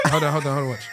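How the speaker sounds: usual speaking voice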